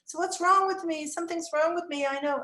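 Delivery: high-pitched mock wail, loud at first; performed child distress. Teacher performs boy's feelings for him, high hammed-up distress. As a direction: despair like an overwhelmed little boy. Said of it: "he's hearing me performing".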